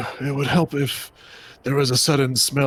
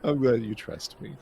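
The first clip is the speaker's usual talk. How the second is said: ordinary talk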